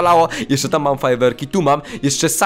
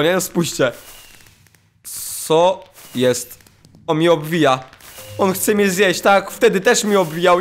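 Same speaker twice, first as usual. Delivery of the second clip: ordinary talk, nothing marked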